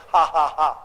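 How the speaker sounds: low, deliberate sarcastic ha-ha-ha; mock the excuse with a spoken laugh; dry pretend laughter; mocking fake laugh. Mocks so-called helpful critics.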